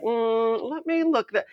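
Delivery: high-pitched musing hum and remark; playfully scrutinizing, very high voice; mock suspicion. Acting out own accent-spotting game.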